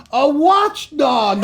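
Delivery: high, slow, drawn-out reveal; hammed-up joke answer; punchline. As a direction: stretch the pun out.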